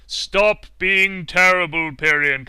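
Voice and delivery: loud, slow stern rebuke over voice chat; slow loud stern scolding; scolding teammate. Retold or imitated. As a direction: boom it out like a disappointed teammate.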